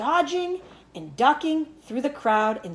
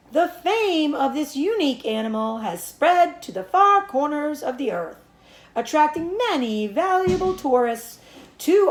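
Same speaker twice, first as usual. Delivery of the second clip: higher, louder grand PA address; pompous announcer; 5 semitones higher, 5 dB louder than usual. A narrator's reading. Announcer presenting the famous pig to the crowd, pompous boom. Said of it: "said the loudspeaker".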